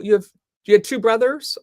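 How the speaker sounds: normal talking voice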